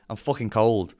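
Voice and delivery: normal talking voice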